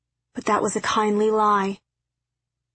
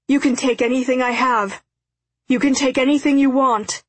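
rising, louder frantic plea; beg, voice climbing in panic; desperate pleading; 4 semitones higher, 4 dB louder than usual. A narrator's reading. Captive begs for his life.

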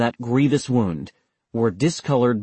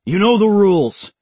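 sharp, loud, high rebuke; chiding; 9 semitones higher, 6 dB louder than usual. A narrator's reading. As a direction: snap at him to shut up. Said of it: "chided one of the other soldiers".

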